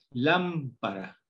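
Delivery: "Lámpara" said slowly, every syllable drawn out; slowly spelled-out example word. Shown as a demonstration. Teacher sounding out "Lámpara" for the class, slowly. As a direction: sound out "Lámpara" for first graders. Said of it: "L A M".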